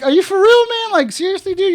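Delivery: high, loud disbelieving outburst; incredulous. Retold or imitated. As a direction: react like you can't believe it. People reacting in disbelief.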